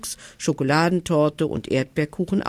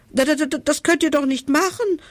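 much higher, stammering in horror; horrified stutter; 10 semitones higher than usual. Acted. Leader horrified the king might boil their clothes, stuttering. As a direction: stumble over the first words in shock. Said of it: "stotterte der Anführer entsetzt".

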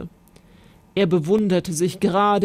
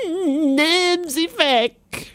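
terrified scream; 8 semitones higher, much slower than usual. Acted. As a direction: shriek at the spider. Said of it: "schrie sie".

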